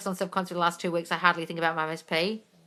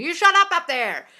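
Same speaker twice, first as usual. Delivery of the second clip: loud, slow, higher shout upward; neighbors yelling; 10 semitones higher, 8 dB louder than usual. Retold or imitated. Neighbors yell up at the shouting author, loud and slow. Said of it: "the neighbors started yelling at him".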